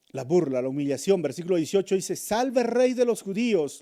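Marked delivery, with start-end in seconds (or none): 2.1-3.8 high, slow mocking hail; mock acclaim. Soldiers mocking Jesus as king, slow mock acclaim. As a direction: hail him in scorn. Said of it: "lo aclamaban".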